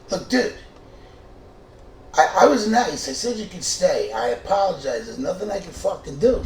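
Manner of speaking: high, loud exasperated pleading; heated argument. Retold or imitated. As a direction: yell that you did everything right. Arguing with roommate in kitchen.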